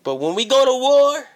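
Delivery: loud, high threatening shout. Acted. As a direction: yell it like a battle cry. Promo threat.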